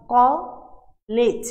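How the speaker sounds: কলেজ broken into ক and লেজ with a gap between; split into syllables. Shown as a demonstration. Teacher splits college into two syllables for students, clearly separated. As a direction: say ক, pause, then লেজ. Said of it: "দুইবারে আমি".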